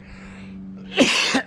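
dry unvoiced cough; coughing on a bug. Real, not acted. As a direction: cough to clear your throat. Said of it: "I feel it squirming in the back of my throat".

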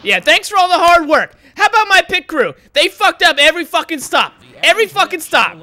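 very high, loud bitter mock thanks; sarcastic furious yell. Real, not acted. Racer yelling at his pit crew, sarcastic rage.

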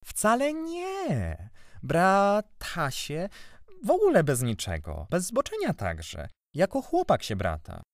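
high, very drawn-out denial; stubborn. A narrator's reading. Boy denying uncle's accusation, stubbornly. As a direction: insist slowly in a lifted voice. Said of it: "odparłem uporczywie".

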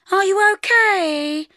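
high, slow concerned woman; woman's voice. A narrator's reading. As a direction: ask gently in her voice. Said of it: "she said".

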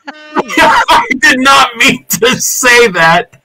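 loud, high, slow laughing cry of embarrassment; mortified laughing yell; laughing shout. Real, not acted. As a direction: howl it out through laughter at the slip. Embarrassed after slip.

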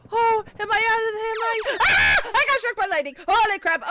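high, loud startled yelling; shocked shouting. Real, not acted. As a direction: yelp in shock. Struck by lightning in game.

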